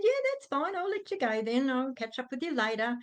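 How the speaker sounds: very high cheery start, easing down; put-on breeziness. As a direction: brush it off with forced good cheer. Keeps up fake cheer on phone.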